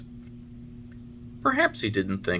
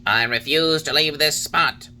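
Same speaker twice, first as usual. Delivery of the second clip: a higher, louder, wise little cricket voice; Talking Cricket; 6 semitones higher, 5 dB louder than usual. A narrator's reading. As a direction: voice the old cricket, stern and knowing. Cricket refuses to leave.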